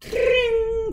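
high, loud mock phone ring; playful sung ring tone; imitated phone ring. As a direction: ring out like a telephone bell. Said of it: "and the ring goes".

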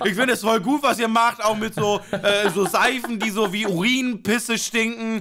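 loud, high, tumbling over the words; flustered gushing. Retold or imitated. Gushing loudly at the receptionist to pass the vegan test. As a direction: overdo the eager agreement under pressure.

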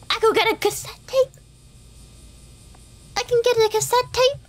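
silly high voice. Pretend. High put-on voice reading options.

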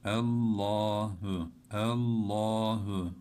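Allahu with a thick, dark lam, slow; heavy lam. Shown as a demonstration. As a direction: say Allahu with a full velarized lam. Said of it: "kalın okuyoruz ve kalın bağlıyoruz".

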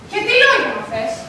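ordinary delivery

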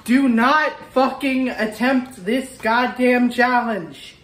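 very slow, each word stressed; emphatic warning. Real, not acted. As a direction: spell out the warning slowly. Warning viewers off challenge.

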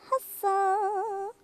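ordinary delivery

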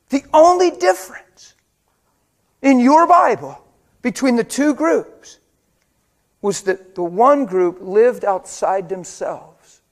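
loud, slow, ringing preacher's emphasis; preaching at full force. Acted. Pastor driving the point home to his congregation, loud and slow. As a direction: hammer each word out to the church.

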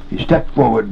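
usual speaking voice

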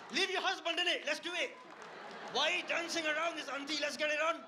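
high, loud comic outburst; mock-defiant shouting. Comedian daring the nosy auntie back, mock-defiant shouting. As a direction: shout it like a challenge, pitched right up.

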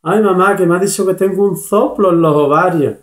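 a hammed-up teary lament; crying daughter. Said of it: "sale la niña llorando".

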